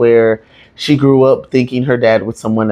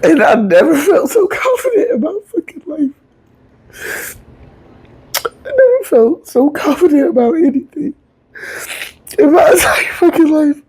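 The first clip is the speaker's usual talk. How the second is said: tearful, breaking whisper, far higher, with a laugh through it; crying; 13 semitones higher than usual. Real, not acted. Telling the crowd about her late dad, crying. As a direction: choke out the words through real tears. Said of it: "Oh, I got emotional".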